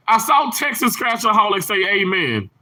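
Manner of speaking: normal talking voice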